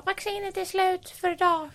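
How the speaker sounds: high, slow put-on announcement; drawn-out high mocking voice; mock clerk. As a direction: mimic a clerk breaking bad news. Mimics clerk turning speaker away.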